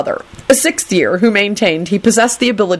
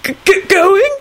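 stammered, squeaky question; very high, startled stutter; high-pitched squeak; slower than usual. A narrator's reading. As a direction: squeak it out in alarm. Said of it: "squeaked out in a high-pitched voice".

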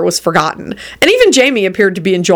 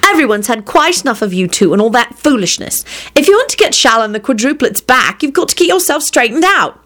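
higher-pitched, exasperated telling-off; snappish scolding; 6 semitones higher than usual. A narrator's reading. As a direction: scold a sibling with rising pitch. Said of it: "she snapped".

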